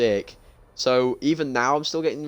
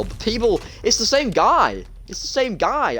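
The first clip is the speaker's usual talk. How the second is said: exasperated outburst; 10 semitones higher than usual. Real, not acted. Claymore camper killed him again.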